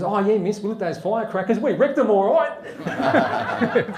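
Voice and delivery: loud, high pupil's punchline; cheeky schoolboy. Acted. Pupil's punchline shouted to teacher.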